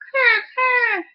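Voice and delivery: high croaking caw; high rasping bird croak; raven croak. Acted.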